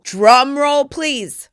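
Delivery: loud, high announcer call; grand announcement. Real, not acted. Host revealing her new line, loud showy announcement. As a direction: call it out like a fanfare.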